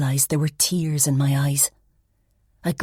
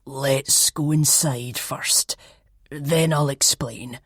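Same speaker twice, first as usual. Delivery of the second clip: insisting; slower than usual. Acted. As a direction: press them calmly to go in. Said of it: "the older man insisted".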